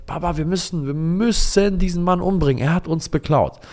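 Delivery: put-on outraged boy demanding revenge; appalled and outraged. Son demands father kill the thief, high outraged child. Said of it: "komplett entsetzt und empört".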